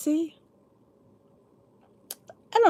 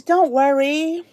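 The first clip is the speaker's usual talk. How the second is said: mock reassurance; 5 semitones higher, 5 dB louder, slower than usual. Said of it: "but employees".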